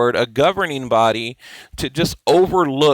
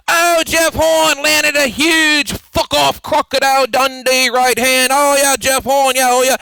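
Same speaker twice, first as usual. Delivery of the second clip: mocking Aussie commentator; 11 semitones higher, 4 dB louder than usual. Vlogger mocks the fight's Aussie commentators, high gushing voice. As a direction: ham up the excited Aussie announcer. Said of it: "I hear their voice in my head".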